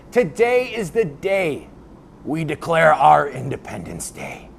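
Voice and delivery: slow, grand proclamation; mock speech declaration. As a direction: declare it like a rally speech, slowly. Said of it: "gave a speech in which he declared".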